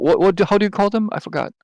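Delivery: usual speaking voice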